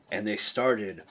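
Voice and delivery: plain reading voice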